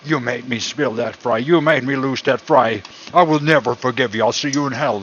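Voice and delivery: loud angry yell at an online troll; mock troll rant. Cook yells at an online troll, mock furious shouting. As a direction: rage at the troll over a lost fry. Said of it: "I'm gonna tell him".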